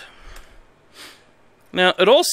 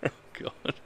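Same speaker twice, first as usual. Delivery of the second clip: breathless laugh; 16 dB quieter than usual. Real, not acted. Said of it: "I'm fucking crying here".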